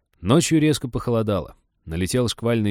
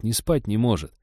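usual speaking voice

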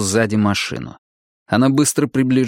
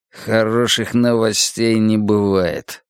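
usual speaking voice

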